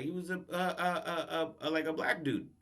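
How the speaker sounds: quiet, repeated stammer while searching; imitated floundering. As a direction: stall on the article, groping for an answer. Flustered man invents a description.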